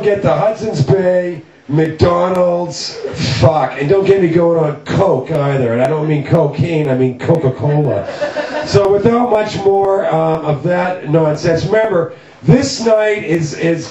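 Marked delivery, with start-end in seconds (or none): none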